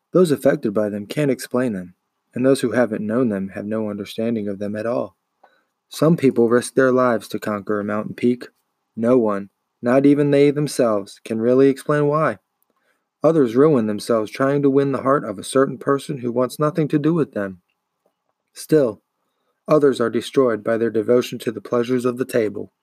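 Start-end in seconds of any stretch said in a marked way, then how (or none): none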